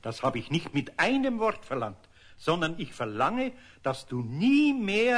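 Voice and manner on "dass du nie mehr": slow, stressed nie; slow stern emphasis; stern insistence. Acted. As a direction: lean hard on nie.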